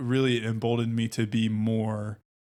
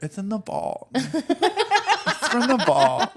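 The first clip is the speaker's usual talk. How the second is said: imitating [name]; 12 semitones higher, 4 dB louder, much slower than usual. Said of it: "It's like [name]".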